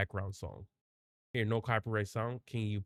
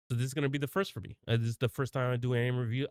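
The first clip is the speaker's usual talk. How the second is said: ordinary talk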